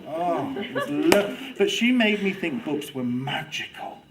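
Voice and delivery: ordinary talk, nothing marked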